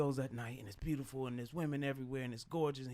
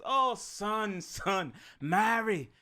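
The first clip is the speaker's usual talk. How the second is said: Jamaican queen's voice; 3 semitones higher, 4 dB quieter, much slower than usual. Retold or imitated. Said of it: "I like that accent".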